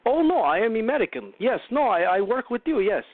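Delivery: playful fake Russian accent; mock Russian accent. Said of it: "they're always talking like this".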